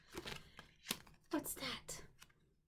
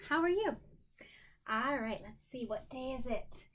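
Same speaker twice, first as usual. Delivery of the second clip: usual speaking voice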